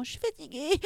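high, small, soft complaint; rebellious whine. Retold or imitated. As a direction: imitate a younger sister feebly protesting. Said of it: "quand je voulais me rebeller en disant". (petite plainte aiguë de cadette qui se rebelle)

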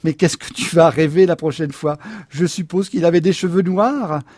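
loud, mocking incredulity; loud scornful husband; sarcastic exclamation. A narrator's reading. Husband mocks wife's dream.

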